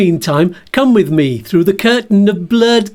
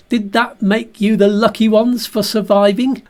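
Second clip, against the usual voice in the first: usual speaking voice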